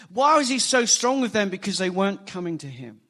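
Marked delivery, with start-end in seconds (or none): none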